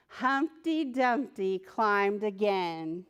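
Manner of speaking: ordinary delivery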